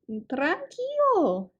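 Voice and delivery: high, slow soothing mouse squeak; squeaky mouse. A narrator's reading. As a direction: soothe him like a tiny mouse. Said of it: "chía el ratón".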